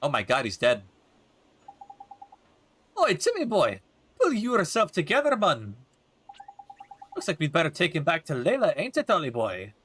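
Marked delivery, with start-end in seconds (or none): 3.0-9.7 put-on cheeky Welsh accent, higher and bouncy; broad Welsh lilt, chatty and brash; Welsh fairy sidekick. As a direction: play the Welsh fairy sidekick with some swagger. Voices Drippy in game.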